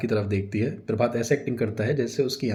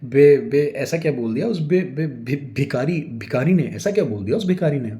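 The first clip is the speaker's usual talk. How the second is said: loud stuttering fumble to a new word; stammering improvisation; 4 semitones higher, 6 dB louder than usual. A narrator's reading. Husband covering a slip to his wife, stammering. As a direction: stutter while inventing a word. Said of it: "ज़बान पर control करके improvise करते हुए".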